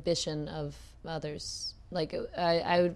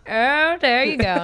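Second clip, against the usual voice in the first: loud, higher hammed-up Texan drawl; Texas accent; 7 semitones higher, 11 dB louder than usual. Texan guy parodying his home state in a hammed-up Texas accent. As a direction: play a cheesy friendly Texan. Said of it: "all Texans are like".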